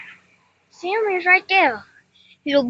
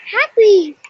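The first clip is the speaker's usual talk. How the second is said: excited shout; 7 semitones higher, 7 dB louder than usual. Real, not acted. Cheerful exclamation.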